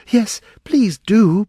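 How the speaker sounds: high, drawn-out loud plea; pleading. A narrator's reading. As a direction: beg them earnestly. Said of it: "pleaded Alice".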